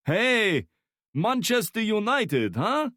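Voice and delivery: put-on high, loud Slavic-accented Manchester United; heavy Slavic accent. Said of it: "speaking in a heavy Slavic accent".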